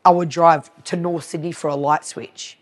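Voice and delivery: ordinary talk, nothing marked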